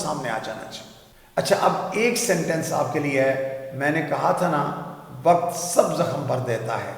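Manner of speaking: ordinary talk, nothing marked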